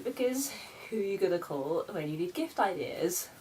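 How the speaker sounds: ordinary delivery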